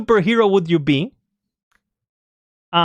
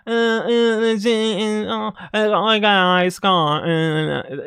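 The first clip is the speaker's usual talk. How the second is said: imitating the annoying host; slower than usual. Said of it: "stop pretending I know how to imitate her".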